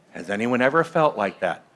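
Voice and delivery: usual speaking voice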